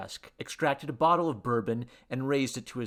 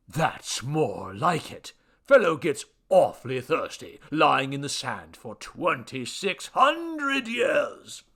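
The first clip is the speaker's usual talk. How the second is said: slow, loud, theatrical grumble; pompous deity with a thirst; satisfied little god; 3 semitones higher, 6 dB louder, slower than usual. Acted. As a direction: complain expansively, stretching the words. God voice after drinking.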